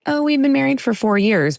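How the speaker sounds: normal talking voice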